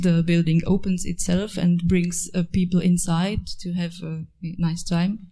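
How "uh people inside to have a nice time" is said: ordinary talk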